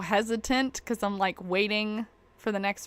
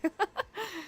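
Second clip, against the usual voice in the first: giggle; 11 semitones higher, 7 dB quieter than usual. Real, not acted. Laughing at being poor.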